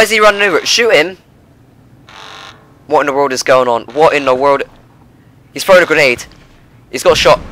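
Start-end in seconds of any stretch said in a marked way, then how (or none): none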